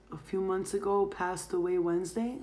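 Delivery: ordinary talk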